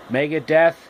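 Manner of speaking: plain reading voice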